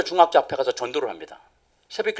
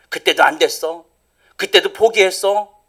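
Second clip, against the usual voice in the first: usual speaking voice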